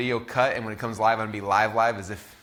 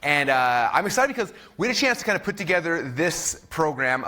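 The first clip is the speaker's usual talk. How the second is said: louder, higher, high-energy host voice; stage presenter; 4 semitones higher, 4 dB louder than usual. Acted. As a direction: open the show like a keynote. Goes live on stage.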